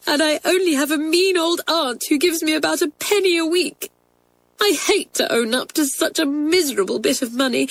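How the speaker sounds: high, plaintive tearful voice; sobbing. Acted. Motherless girl confesses to twins, sobbing. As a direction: pour out troubles in a high, crying voice. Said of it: "pouring out her troubles between her sobs".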